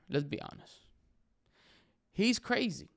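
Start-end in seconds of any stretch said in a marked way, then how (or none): 2.1-2.9 mock alarm. Real, not acted. Mocking always-happy people.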